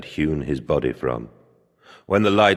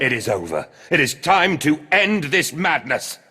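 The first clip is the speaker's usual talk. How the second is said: louder and higher, fierce resolve; defiant shout; 10 semitones higher, 5 dB louder than usual. Acted. Mandus shouting down the machine as he destroys it, loud and fierce. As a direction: declare the end with full force.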